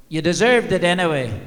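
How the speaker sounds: put-on wife's jibe in a loud laughing Irish accent. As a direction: tease him in a loud, laughing mock Irish lilt. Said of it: "said in this Irish accent".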